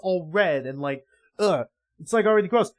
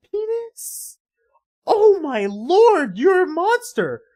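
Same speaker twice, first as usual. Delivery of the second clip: horrified; 11 semitones higher, 4 dB louder, slower than usual. Real, not acted. Horrified reaction to comic scene.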